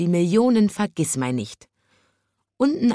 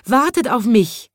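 higher calling after the birds; 6 semitones higher, 3 dB louder than usual. A narrator's reading. Chimney sweep calling after the birds, a touch higher. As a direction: call ahead to the flock. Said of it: "schrie er".

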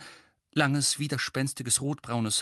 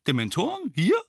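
startled question, pitched up; flabbergasted; 8 semitones higher, 4 dB louder than usual. A narrator's reading. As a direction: ask in stunned disbelief. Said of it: "wiederholte Mandangus verdattert".

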